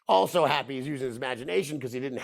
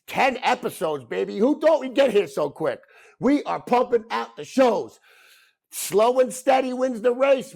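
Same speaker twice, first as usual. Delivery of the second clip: hyped up; 8 semitones higher, 4 dB louder than usual. Real, not acted. Hyped thanks to audience.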